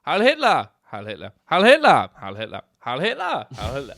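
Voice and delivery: loud, high repeated salutes fading away; mock salute chorus, loud then fading. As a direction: chant the salutes over and over, dying down. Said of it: "it's long and it's drawn out".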